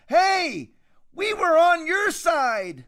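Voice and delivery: high, slow mock plea; pleading French victims. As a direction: play a doomed aristocrat begging the mob. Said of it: "as they said to the revolutionaries".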